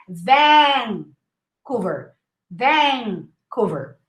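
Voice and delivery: Vancouver slowly, first A stretched; long A. Shown as a demonstration. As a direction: draw out "vaan" in Vancouver. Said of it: "six A's".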